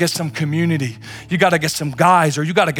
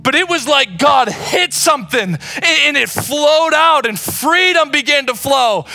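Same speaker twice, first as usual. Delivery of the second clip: high and loud, driving hard through the story; preaching at full force; 10 semitones higher, 5 dB louder than usual. Acted. Pastor testifying his struggle to the church, shouting. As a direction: testify at the top of your voice.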